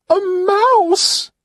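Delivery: very high, loud shriek of a question; panicked high-pitched squeal; shrieking at a mouse. Acted. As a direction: scream at the sight of a mouse. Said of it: "She screams again".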